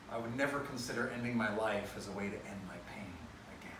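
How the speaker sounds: soft unvoiced whispered vow; whispering. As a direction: whisper it like a secret oath.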